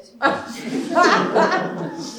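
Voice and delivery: loud, high burst of laughter; big awkward guffaw; nervous laugh. Acted. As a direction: laugh uneasily at your own confession. Admits she did serious damage.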